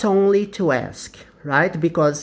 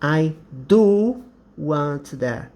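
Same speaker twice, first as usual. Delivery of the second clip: slow, weighted "do" in "I do want that"; emphatic "do", drawn out slowly; stress on do; 3 semitones lower, slower than usual. Shown as a demonstration. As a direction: lean hard on "do" in "I do want that". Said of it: "when you want to emphasize something".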